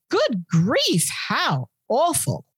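mock feigned shock. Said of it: "feigns shock and surprise".

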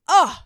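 loud, swooning "ugh"; short burst of fangirl exasperation; exasperated fan groan. Real, not acted. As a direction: let out a loud, overcome groan. Swooning over a favourite TV scene.

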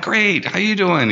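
high-pitched, friendly retold hello; upbeat reply. Bartender answers the bar owner's greeting, high and upbeat. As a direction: bounce the greeting back high.

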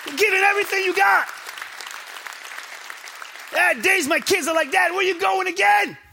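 high, loud, fast preacher push; preaching at full force. Acted. Pastor telling the church about his kids, loud preaching. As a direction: lift it and press on.

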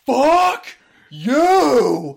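hammed-up raging yell; furious shout. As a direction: explode into a yelled insult. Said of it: "and he goes".

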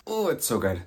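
usual speaking voice

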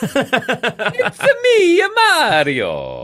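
high, slow cartoon Italian accent; Mario voice. Host doing Mario for co-host, cartoon Italian accent. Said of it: "you sound Italian".

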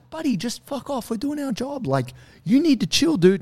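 hammed-up fed-up order; exasperated warning. Arborist telling the bro off again, exasperated. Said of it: "She kept going like".

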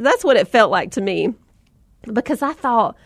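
ordinary delivery

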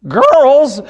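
high, outraged father's question; acted shocked double take; incredulous echo. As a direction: pounce on the word in disbelief. Father echoes the word, incredulous.

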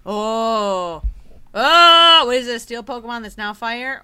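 ordinary talk

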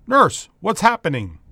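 loud call down the hallway. Acted. Doctor calling down the hall to the nurse, loud and alarmed.

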